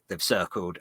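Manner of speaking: ordinary talk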